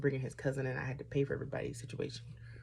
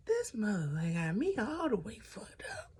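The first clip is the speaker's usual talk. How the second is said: higher hissed whisper of disbelief; furious inner thought; 6 semitones higher than usual. Retold or imitated. Recalling her furious thought on seeing him, hissed. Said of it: "is what I was thinking".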